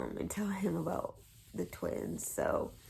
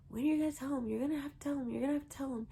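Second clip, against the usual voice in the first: higher-pitched imitation of an insistent mom; retold nagging; 7 semitones higher, much faster than usual. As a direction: press her to confess, pitched up. Mother and sister pressing her.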